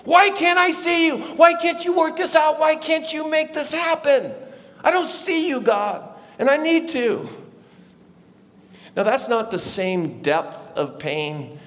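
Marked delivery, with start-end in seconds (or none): 0.1-7.3 high, anguished shout pleading upward; having it out with God. Retold or imitated. Retelling his night on the beach crying to God, anguished shout. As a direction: cry out in desperate protest, easing at the end. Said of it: "I just have it out with God".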